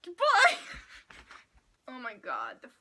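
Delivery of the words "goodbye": mock shouted goodbye. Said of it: "I would've been like".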